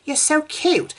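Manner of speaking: put-on high, adoring girl's coo; gushing girl. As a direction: gush over the cute little creature. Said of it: "she crooned".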